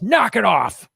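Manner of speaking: retold loud telling-off; imitated loud voice. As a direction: imitate a dad firmly putting his foot down. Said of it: "he has a very loud voice".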